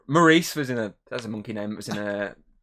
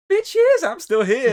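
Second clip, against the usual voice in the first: much higher and louder, gleefully mocking; the dinosaur gloating at its prey; taunting monster; 13 semitones higher, 7 dB louder, much slower than usual. Voicing the taunting Indominus.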